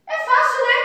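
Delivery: É fácil, lifted high and bright; cheery encouragement. Acted. Teacher cheering pupils that the task is easy, cheerful. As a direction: sing it up to make the task sound easy.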